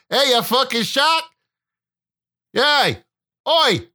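high, shouted cursing at the water; mock yell at shark. Husband yelling Aussie curses at the shark from the beach.

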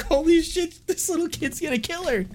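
high, squeaky mock shock. Viewer reacts to a kid beating her sister, high squeaky shock. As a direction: squeal it in put-on disbelief. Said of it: "I'm like".

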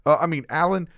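high, stumbling mock voice tripping over the name; stuttery coworker. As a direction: stutter and correct yourself in a fluster. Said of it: "he'll go".

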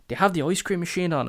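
lightly voiced kid asking; mimicked kid. Kid asks shop worker about ice cream, quick mimicked kid.

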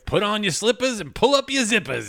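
hammed-up show intro. Playful intro jingle voice.

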